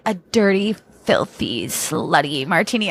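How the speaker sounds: low, imitated suggestive order; mock sultry. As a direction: parody a lusty drink order. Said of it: "while they act that way".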